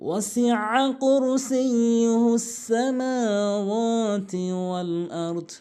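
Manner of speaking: slow chanted tajweed recitation; Quran recitation. Acted. Teacher recites the verse for students, slow melodic chant.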